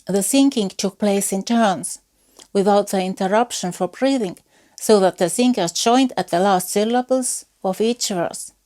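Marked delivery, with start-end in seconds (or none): none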